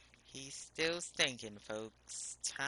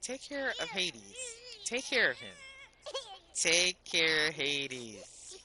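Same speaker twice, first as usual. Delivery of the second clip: pleading command; 4 semitones higher, 6 dB louder than usual. Real, not acted. Urging the game to comply.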